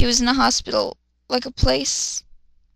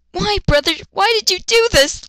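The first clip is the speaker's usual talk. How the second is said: put-on higher, pleading girl voice; hint of a screaming girl; 8 semitones higher, 4 dB louder than usual. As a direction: cry out to a brother, lightly voiced. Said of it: "Luna screamed at Kenta".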